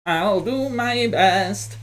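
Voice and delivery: high, slow little robot voice; earnest little robot reply, high and slow. Acted. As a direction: answer bravely like a tiny machine. Robot answers in character.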